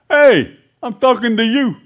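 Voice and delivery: loud, high-pitched bark of a soldier; commanding shout. Acted. Roman soldier hailing a farmer in the field, commanding shout. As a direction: holler an order across a field.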